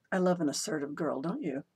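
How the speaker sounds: ordinary delivery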